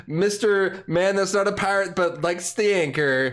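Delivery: upbeat host intro voice; podcast intro. Acted. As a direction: announce the show with energy.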